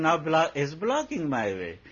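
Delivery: a swami mimics a devotee blaming the dog; mock complaint. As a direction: parody the devotee whining about blocks.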